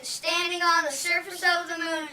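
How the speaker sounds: loud broadcast announcer; newscaster. Retold or imitated. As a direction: read it like a live TV anchor. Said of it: "Mister Cronkite said".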